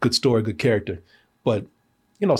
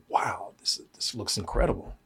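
soft, half-whispered wonder; quietly amazed, under the breath; hushed awe; 5 semitones lower, 10 dB quieter than usual. Retold or imitated. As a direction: murmur it low, as if absorbed in the screen. Retelling own awed reaction while watching.

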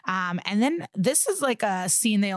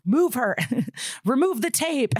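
mock frantic yelling; 6 semitones higher than usual. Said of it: "keeps screaming uneducated suggestions".

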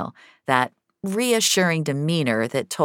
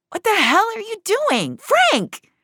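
much higher, startled outburst; alarmed cry; 4 dB louder than usual. A narrator's reading. Finch finds Frank pointing a pistol, crying out in alarm.

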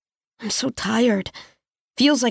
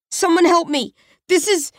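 higher, panicked call; begging someone to hear; cry for help; 7 semitones higher than usual. Acted. Trapped, calling for help.

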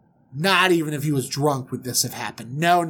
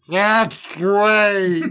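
loud, higher, drawn-out reply; overwhelmed groom; 5 semitones higher, 4 dB louder than usual. Acted. Smitten groom answering his father-in-law, overwhelmed.

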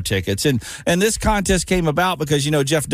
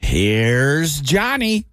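very slow, drawn-out mock movie menace; terrible Jack Nicholson impression; 3 semitones lower, much slower than usual. Host doing a bad Nicholson for callers. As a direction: stretch it out like a hammy horror villain. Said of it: "It was a terrible impression".

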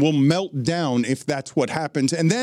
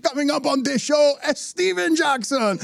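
Steven Jackson; 12 semitones higher than usual. Retold or imitated. Impersonator mimicking the father back, cartoonishly high. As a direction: mimic a cartoonish show host, pitched way up. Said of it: "And he's like".

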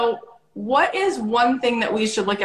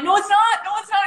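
higher and louder playful denial; mock protest; 11 semitones higher, 4 dB louder, faster than usual. Wife protesting her husband's teasing, high playful denial. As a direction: insist it isn't so, pitched way up. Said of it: "I'm like".

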